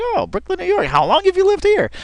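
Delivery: a sincere, curious asker, voiced up high; sincerely interested woman. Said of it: "It's kinda sincere".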